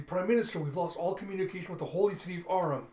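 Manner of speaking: high, hurried emergency report; urgent alarm. Acted. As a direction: blurt the bad news to your leader. Acted urgent report to leader.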